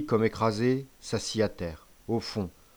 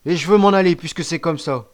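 put-on high, loud childish complaint; petulant protest; 6 semitones higher, 9 dB louder than usual. A narrator's reading. Accused boy wanting to leave, petulant protest. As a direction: insist on getting out, voice pitched up.

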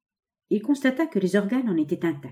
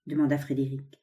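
usual speaking voice

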